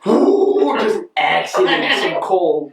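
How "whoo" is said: excited whoop. Retold or imitated. Whoop while rolling coal.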